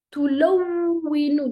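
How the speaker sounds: "Tout la oui, nous" said slowly, W held double; teacher repeats the example for students, slowly; slow shadda repeat. Shown as a demonstration. تكرار بطيء. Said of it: "regardez ici, quand on prononce".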